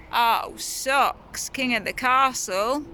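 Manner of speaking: ordinary talk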